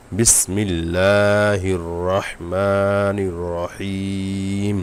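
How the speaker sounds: বিসমিল্লাহির রহমানির রহিম sung out very slowly, every vowel stretched; slow Quran recitation. Shown as a demonstration. Teacher recites the full verse to students, slowly chanted. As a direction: chant the whole verse, drawing it out. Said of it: "শব্দ শব্দ ভেঙে ভেঙে পড়ে".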